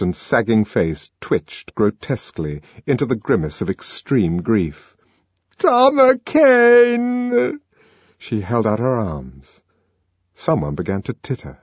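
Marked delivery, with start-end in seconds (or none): 5.6-7.6 very high, long drawn-out call; anguished cry. A narrator's reading. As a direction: reach for him with the name. Linda calling out, drawn out.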